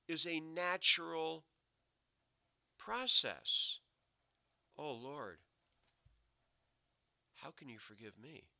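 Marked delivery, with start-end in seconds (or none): 4.8-8.5 acted contrite plea, very soft and low; hushed penitent prayer. Sinner pleading with God for forgiveness, hushed and low. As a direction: drop to a near-murmur, as if praying in remorse.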